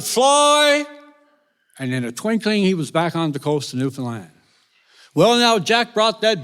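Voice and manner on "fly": high, held-out call; shout. Acted. Jack aboard the punt heading home, shouting high. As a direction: call out the magic word, stretched high. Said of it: "He shouted".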